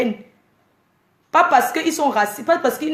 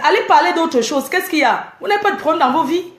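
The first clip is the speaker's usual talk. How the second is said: ordinary talk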